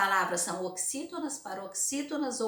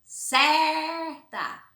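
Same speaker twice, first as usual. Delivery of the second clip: Certa with CER stretched, higher and louder; stress on CER; 8 semitones higher, 7 dB louder than usual. Shown as a demonstration. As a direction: hold the CER of Certa high. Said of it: "É só vocês pronunci".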